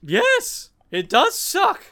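squeaky high dragon agreement. Acted. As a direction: pitch way up as the dragon.

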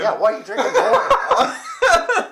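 high squeaky laughing question. Real, not acted. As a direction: laugh through the words. Laughing at friend drinking again.

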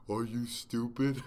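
slow, put-on antagonist voice; low antagonist voice. Host imitating how an online antagonist talks, slow mocking voice. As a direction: draw it out as a mocked opponent. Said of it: "antagonist voice is always like a low".

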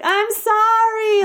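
high, slow mock yell of sorry; yelled apology. Guard calling after her car, mock yelled sorry. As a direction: call it out after a departing car. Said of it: "he yelled it out".

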